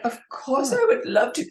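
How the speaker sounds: high, refined British reply; imitating a gracious British actress; posh British accent. Accepting the invitation to the play in a British accent. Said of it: "Very British".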